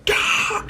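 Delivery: breathy high strained grunt-yell; effort yell. Acted. Izuku forcing his power, raw effort cry. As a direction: let out a burst of effort.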